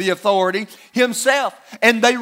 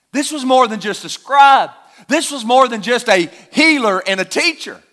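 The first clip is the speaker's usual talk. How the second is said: ordinary talk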